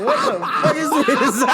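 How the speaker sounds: high laughing yell of disbelief; mock outrage; outraged yell. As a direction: blurt it out half laughing. Retold outrage at advice.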